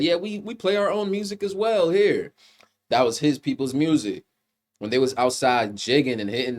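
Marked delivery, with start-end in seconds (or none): 0.0-2.3 higher put-on voice of the Irish vampire, smug; quoting the villain. Reviewer mimics villain claiming his people's music, higher put-on voice. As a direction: voice the villain boasting about his own music. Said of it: "That's why he said".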